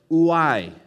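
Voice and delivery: "ooi" loud, weight on the final I; accent on final I. Shown as a demonstration. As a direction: say "ooi" loudly, stressing the closing "eye" sound. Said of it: "with an emphasis on the I".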